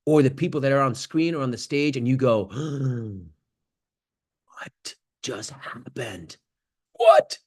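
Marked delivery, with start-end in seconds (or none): 2.5-3.2 soft stunned intake of breath; awed gasp. Retold or imitated. Audience member stunned by a show, gasping softly. 4.5-6.4 very slow, much softer awed question; stunned awe. Retold or imitated. Audience member after a great show, slow and hushed. 6.9-7.4 much higher, louder burst; amazed exclaim. Retold or imitated. Audience member after a great show, bursting out in disbelief.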